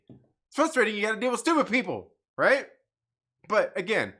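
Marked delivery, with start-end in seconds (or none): 0.1-2.6 higher, louder exasperation; exasperated. Real, not acted. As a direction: vent the frustration building up.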